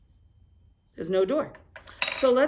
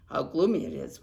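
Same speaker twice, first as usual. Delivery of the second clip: plain reading voice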